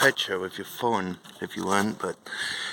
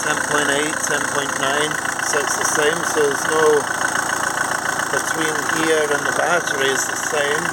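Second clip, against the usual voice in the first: raised voice over a running engine; shouting over engine; 4 semitones higher, 4 dB louder than usual. Real, not acted. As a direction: project loudly over engine noise. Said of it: "I don't know if you can hear me".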